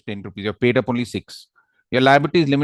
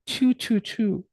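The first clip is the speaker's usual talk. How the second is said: high, puckered "Chew" copying the student; imitating cute chew; 10 semitones higher, much slower than usual. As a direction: mimic her cute kissy "Chew". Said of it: "she did it so cutely".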